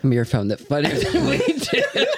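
laughing. Real, not acted. Hosts cracking up.

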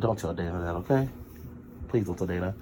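low, hushed plea for secrecy; confidential aside. Real, not acted. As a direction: drop right down as if confiding.

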